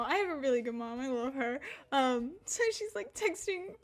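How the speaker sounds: normal talking voice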